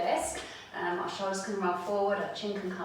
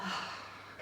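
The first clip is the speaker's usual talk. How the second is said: audible exhale sigh; 8 dB quieter than usual. Shown as a demonstration. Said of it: "all the way out".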